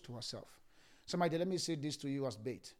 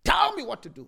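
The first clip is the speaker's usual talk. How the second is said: shout; 13 semitones higher, 14 dB louder than usual. Acted. Crying out to God.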